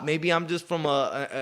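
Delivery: ordinary talk, nothing marked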